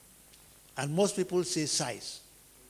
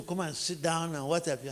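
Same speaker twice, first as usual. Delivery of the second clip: usual speaking voice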